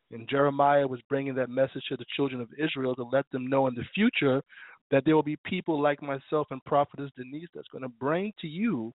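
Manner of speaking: normal talking voice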